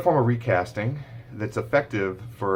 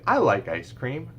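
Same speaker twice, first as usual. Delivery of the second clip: higher, slow modelling voice; gentle sing-song model; parentese model; 6 semitones higher, slower than usual. Shown as a demonstration. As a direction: say it slowly for the child to copy. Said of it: "You could say".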